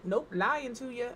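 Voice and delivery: usual speaking voice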